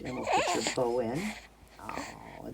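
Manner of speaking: ordinary talk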